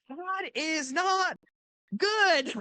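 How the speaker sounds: alarmed. Retold or imitated. Shocked reaction to first contraction.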